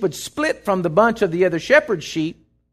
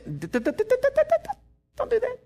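much higher, stammering playful warning; stuttering warning voice; 5 dB quieter than usual. Retold or imitated. The inner voice stopping you mid-act, high and stammering. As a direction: sputter out a squeaky hold-it warning.

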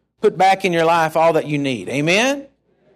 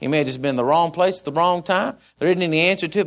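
normal talking voice

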